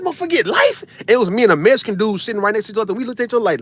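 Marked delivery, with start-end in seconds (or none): 0.0-0.8 high, rising cry of disbelief; shocked disbelief. Retold or imitated. In court, hearing the prosecutor ask for life, shocked. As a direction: shoot up in pitch, can't believe it. Reacting to a life sentence request.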